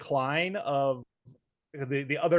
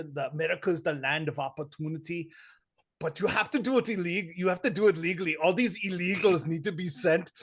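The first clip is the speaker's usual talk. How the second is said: imitated Austrian-accented politician's speech; mock an action star turned governor; Arnold Schwarzenegger; 2 semitones higher than usual. Retelling his View interview on immigration, mock Schwarzenegger voice. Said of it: "He was on The View the other day".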